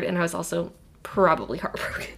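whispered, hurried aside; whispering. Real, not acted. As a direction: whisper the confession. Confessing heartbreak.

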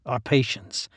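plain reading voice

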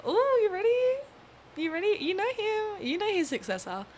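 high, loud, sing-out tease; excited teasing build-up. Real, not acted. Host teases viewers about Lenin's successor, excited and teasing. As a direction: hype up the big reveal.

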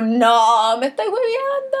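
I can't believe it, said with a jump up in pitch; stunned disbelief. Retold or imitated. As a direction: relive the shock of the twist. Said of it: "y fue como".

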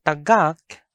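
tagak with loud stressed GAK; short first vowel, weight on the end of tagak; stress on second syllable. Shown as a demonstration. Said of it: "its alternative pronunciation is".

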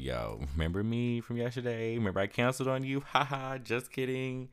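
hammed-up sheepish grin in the voice; mock cheerful. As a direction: play it cheeky, half joking with the company. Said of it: "I'm like".

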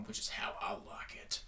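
whispered aside. Real, not acted. Aside muttered to herself.

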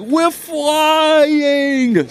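very high, loud goofy holler; big idiot Sasquatch. Acted. Hallucinated Sasquatch soaring with his son, idiot holler. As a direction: cry it out long like a gleeful cartoon oaf. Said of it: "Sasquatch says".

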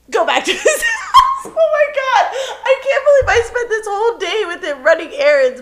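high, laughing in disbelief; laughing exasperation. Real, not acted. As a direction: crack up over the wasted day.

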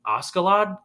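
"Askeladd" said with a hard sk, AH-ska-lad; spelled pronunciation. Shown as a demonstration. Asking co-host about the name, "Askeladd" said as spelled with hard k. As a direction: sound the k in "Askeladd". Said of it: "you're saying".

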